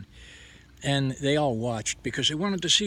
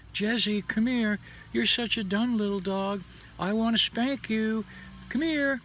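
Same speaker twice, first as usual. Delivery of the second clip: put-on much higher, sing-song baby talk to a dog; sweet tone; 10 semitones higher than usual. Shown as a demonstration. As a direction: coo harsh words sweetly at the dog. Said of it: "dogs don't understand words so much as they do our tone".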